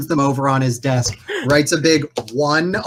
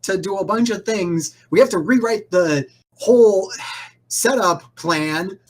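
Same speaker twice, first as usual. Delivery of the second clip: much higher, fast, harried rambling; a manager drowning in last-minute chaos; stressed organizer rushing the party out; frazzled boss; 7 semitones higher than usual. Acted. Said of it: "is all like".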